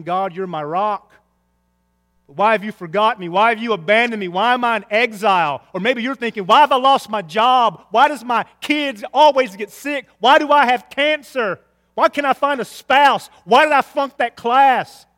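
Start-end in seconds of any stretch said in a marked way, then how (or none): 0.0-5.6 mildly raised, insistent plea; crying out. Acted. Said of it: "now he's crying out and he's saying". 6.4-15.1 loud, high volley of questions; impassioned complaint. Acted. Voices listeners' anguished complaints to God.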